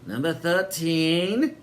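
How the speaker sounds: high, sugary welcoming landlady voice; sinister hostess. A narrator's reading. Landlady welcoming Sid and Eric, high and sweet. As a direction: greet them grandly, a touch too sweet. Helen announcing the room.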